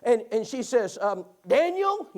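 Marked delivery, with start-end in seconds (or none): 1.5-2.1 high, loud imitated call; put-on wife's summons; wife calling husband. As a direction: call the name sharply, pitched way up. Retold wife calling her husband.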